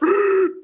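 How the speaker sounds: high pained gasp for air; winded gasp. Retold or imitated. Boy punched in the stomach, high winded gasp. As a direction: gasp like you just took a gut punch.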